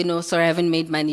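usual speaking voice

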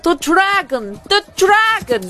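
high panicked crowd cry; frightened crowd shouting. Acted. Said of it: "shouting and crying at the tops".

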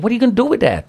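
hammed-up shocked wife; astonished question. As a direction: squeak it out in disbelief. Wife asks about the check.

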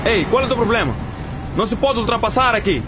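yelling at driver. Acted. Driver yells at reckless driver.

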